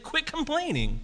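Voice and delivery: high, sharp scolding; angry rebuke. Retold or imitated. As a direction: scold them to quit. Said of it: "This is an angry response".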